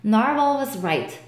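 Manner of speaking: ordinary delivery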